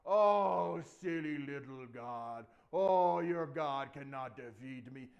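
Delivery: loud, slow, booming mockery; pompous giant's taunt; smack-talking giant. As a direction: jeer with a big drawn-out "Oh". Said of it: "he started smack talking God".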